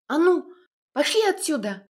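high, loud brave outburst; brave shout. A narrator's reading. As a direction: shout the dogs away boldly. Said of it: "и храбро выпалила".

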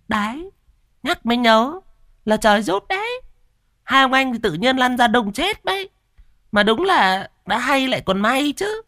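high, preening smug voice; smug wife. Acted. Cúc preening to her husband, smug and high. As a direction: preen at the praise. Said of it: "phỏng mũi".

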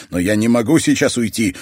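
exclaiming. Acted. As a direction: blurt it out in protest. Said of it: "воскликнул он".

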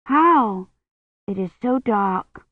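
lightly voiced storybook maiden; doubtful maiden. A narrator's reading. Maiden asks the bear how, doubtful.

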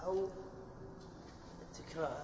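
ordinary delivery

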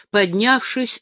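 usual speaking voice

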